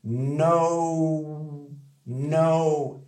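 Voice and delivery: no with the O gliding toward OU; drilled no. Shown as a demonstration. Teacher modelling no for students. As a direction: slide the O of no toward OU and stop. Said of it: "Écoute bien".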